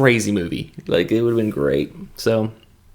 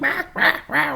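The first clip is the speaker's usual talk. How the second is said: much higher mock chimp screech; chimp freaking out. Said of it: "he's freaking out".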